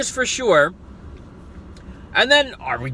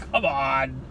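usual speaking voice

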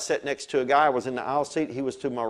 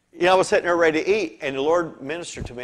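normal talking voice

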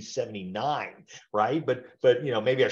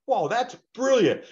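high, slow and louder in mock wonder; retold inner thought, hammed-up delight; amazed; 11 semitones higher, 5 dB louder, slower than usual. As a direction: act bowled over by a clever idea. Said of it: "I'm thinking".

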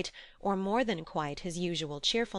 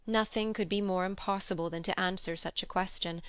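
usual speaking voice

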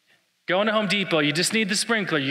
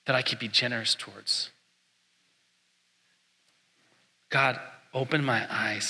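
quiet prayer; 4 semitones lower, 5 dB quieter than usual. Acted. Prayer fades to a quiet plea.

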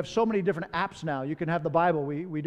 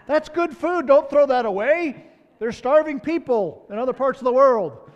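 higher and louder, hammed-up plea for the leftovers; mock pleading shout; 7 semitones higher, 8 dB louder than usual. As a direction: loudly beg her not to waste the food. Husband begging for wife's leftovers.

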